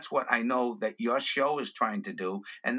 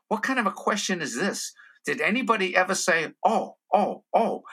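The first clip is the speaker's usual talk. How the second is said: mock disbelief, pitched up; higher, exasperated comic aside; incredulous inner voice; 6 semitones higher than usual. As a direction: question it as if it's absurd. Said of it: "I'm saying to myself".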